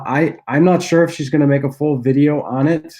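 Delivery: normal talking voice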